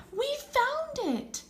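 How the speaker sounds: excited cry. A narrator's reading. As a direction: shout the discovery with delight. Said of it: "Sylvia cried".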